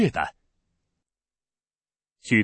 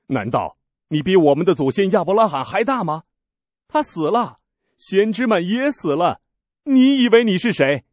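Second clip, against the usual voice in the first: higher and louder, peaking on the last question; indignant challenge; 8 semitones higher, 6 dB louder than usual. Acted. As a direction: demand who he thinks he is. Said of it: "那些犹太人说".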